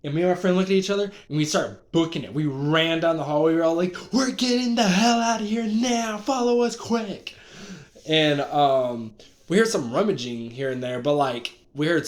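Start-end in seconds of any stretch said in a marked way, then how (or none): 4.1-7.4 panicked urging. Retold or imitated. Fleeing monster, urging friend.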